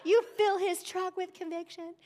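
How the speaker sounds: high, theatrical prayer calling down judgment; mock vengeful prayer. Preacher retells praying against the truck driver, hammed-up and indignant. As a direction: pray it like a self-righteous teenager. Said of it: "I'm like".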